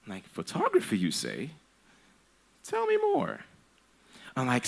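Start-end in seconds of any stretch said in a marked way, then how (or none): 0.3-3.4 much higher, sing-song fake interest; mock fascination. Parent answering the son's photography dream, mock-fascinated and high. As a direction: feign wide-eyed interest, high and sarcastic.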